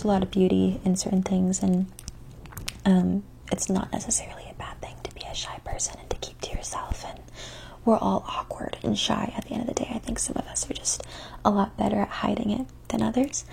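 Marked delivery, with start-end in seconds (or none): 2.9-11.0 soft ASMR whisper. Acted. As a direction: whisper close to the mic.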